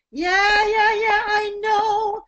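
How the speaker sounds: high, loud, flippant yeahs; saucy attitude. Shown as a demonstration. Voice teacher adding sassy attitude to the yeah exercise. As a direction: brush it off with sass. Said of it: "and then we do a little more attitude".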